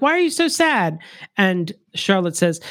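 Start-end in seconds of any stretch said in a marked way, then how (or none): 0.0-1.0 lightly voiced high-pitched concern; Stacy. Babysitter asks a sad child why, lightly voiced high concern. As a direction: gently raise the pitch into a questioning quote. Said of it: "Stacy's like".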